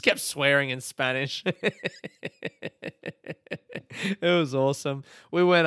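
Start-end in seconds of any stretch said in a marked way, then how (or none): 1.5-4.2 silent breathy laugh. Real, not acted. Recalling funny fan.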